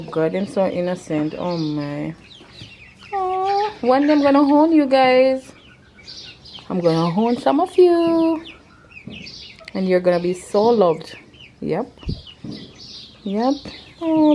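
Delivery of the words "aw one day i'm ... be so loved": high, loving coo; talking to animals. Real, not acted. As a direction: gush over the birds. Cooing at chickens.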